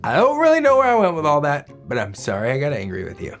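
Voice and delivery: ordinary delivery, nothing marked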